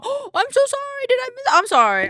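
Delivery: high, loud rushed sorry; loud high-pitched flustered apology. Real, not acted. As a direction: panic as you realize you missed something. Panicked apology to chat.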